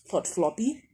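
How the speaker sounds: usual speaking voice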